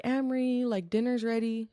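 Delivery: a mild mock yell from downstairs; mom calling up the stairs. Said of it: "my mom screams up to me for dinner".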